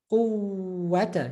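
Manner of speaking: Quwwata with a long held ww; emphasized waw. Shown as a demonstration. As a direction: hold the uww in Quwwata before -ata. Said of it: "we're just emphasizing the waw".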